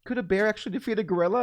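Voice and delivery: lightly voiced mom, high and fast; a hint of a curious mother; imitating mom. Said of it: "she'll call me and be like".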